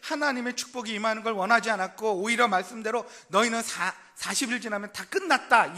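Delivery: loud, driving sermon voice; forceful preaching. Acted. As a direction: press the point hard from the pulpit.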